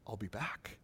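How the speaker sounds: whispered I'll be back, unvoiced. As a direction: breathe it like a secret. Jesus speaking to disciples.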